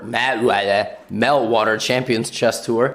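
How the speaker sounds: usual speaking voice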